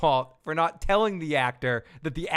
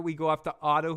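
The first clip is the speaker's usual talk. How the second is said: usual speaking voice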